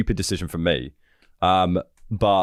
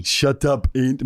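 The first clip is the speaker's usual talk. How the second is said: higher, mock Australian-sounding coach; South African accent; 5 semitones higher than usual. Coach telling Andy to shut up, put-on accent. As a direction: put on a light Aussie-South African twang. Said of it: "Maybe he was Australian".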